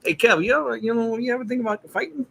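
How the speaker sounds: high-pitched impression of a punchy pal; imitating a friend. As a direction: put on his high, eager voice. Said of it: "that's how he talked".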